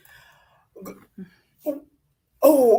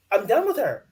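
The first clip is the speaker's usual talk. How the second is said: exasperated; 10 semitones higher, 3 dB louder than usual. Real, not acted. Exasperated rant at cast member.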